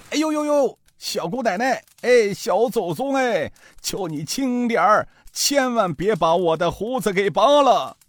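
put-on high, yelping old man's plea; pleading old thief. Caught old thief begs the girl to let go. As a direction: beg for mercy with a comic yelp.

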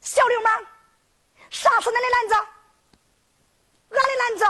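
put-on high-pitched indignant young girl; glaring girl's scolding. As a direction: scold him in a high, acted voice. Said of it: "杏子眼一瞪". (瞪眼娇声怒骂)

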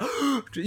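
quick intake of breath in mock shock; sharp shocked gasp.

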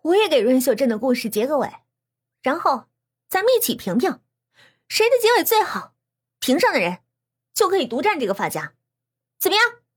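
high, lively, emphatic proposal; animated girl announcing terms; speaking up loudly. A narrator's reading. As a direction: pitch the idea keenly to the whole room. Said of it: "栾婷婷大声地说着".

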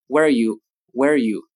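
"Where are you" clipped and reduced; weak forms. Shown as a demonstration. Teacher shows how "Where are you" is reduced. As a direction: blur "Where are you" into a quick unit. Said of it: "where are you会弱读成".

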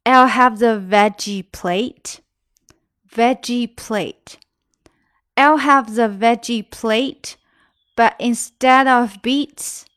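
slow, careful model reading. Shown as a demonstration. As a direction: read it slowly for learners. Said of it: "teacher slows the line for learners". (放慢速度示范朗读)

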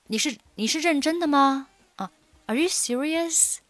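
sarcastic disbelief. Shown as a demonstration. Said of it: "有一点讽刺的意味".

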